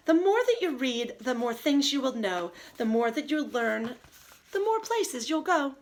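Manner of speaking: lively storybook read-aloud, slowing on the rhymes; reading to children. Acted. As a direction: savor each silly rhyme for the kids.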